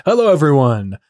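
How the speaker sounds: loud, bright streamer hello; intro greeting. Acted. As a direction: open the show with a big hello. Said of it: "stream intro greeting".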